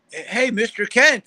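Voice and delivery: slow, high-pitched hail; retold fan greeting. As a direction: imitate a fan calling out hello. Fan greets him in retold story.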